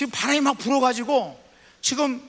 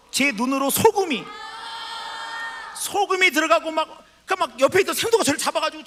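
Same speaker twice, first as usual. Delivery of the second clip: excited retelling; 6 semitones higher than usual. Retold or imitated. Preacher recalling salt water in his eyes, excited and raised. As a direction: relive the sting of salt in your eyes.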